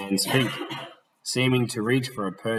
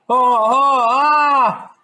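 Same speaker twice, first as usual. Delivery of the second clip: high, loud startled wail; collision cry; 10 dB louder, much slower than usual. Acted. Gentleman crying out as he is knocked down, high loud wail. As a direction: cry out on impact.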